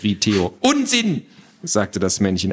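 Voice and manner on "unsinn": very high, pedantic little man; squawky dismissal. Acted. Little man rejects the boy's guess, squawky. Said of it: "eine quäkende Stimme".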